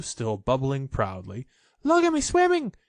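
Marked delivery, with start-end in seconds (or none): 1.8-2.7 very high, loud squeaky little voice; Roo squeaking. A narrator's reading. Roo showing off his swimming, high squeak. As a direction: squeak it like a tiny proud joey.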